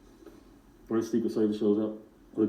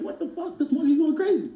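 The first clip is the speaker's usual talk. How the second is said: much higher, startled outburst; shocked. Retold or imitated. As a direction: blurt it out in shock at the monkey. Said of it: "me and my man just like".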